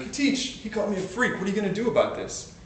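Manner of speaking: put-on a kid calling the teacher over; indignant kid. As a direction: play the wronged kid demanding justice.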